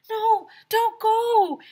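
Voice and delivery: very high, loud, slow whine; pleading yell. A narrator's reading. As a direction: wail it like kids begging. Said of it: "everyone yelled".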